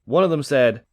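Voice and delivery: plain reading voice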